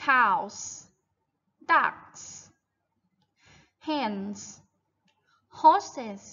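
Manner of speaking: one word at a time, long pauses; measured dictation-like list for pupils to echo; model word list. Shown as a demonstration. Teacher lists farm animals for children to repeat, model word list. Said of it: "repeat after me".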